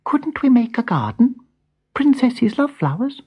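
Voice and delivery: high, shy little bird voice; chirruped shyly. A narrator's reading. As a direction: chirp it out timidly like a small bird. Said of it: "chirruped shyly".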